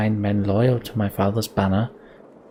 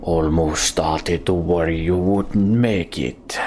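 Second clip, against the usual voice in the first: slow, low-voiced taunting warlord; menacing villain; 3 semitones lower, slower than usual. Warlord greeting old enemies at the tower, mocking menace. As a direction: drawl the taunt like a cruel warlord. Streamer reads the villain's line.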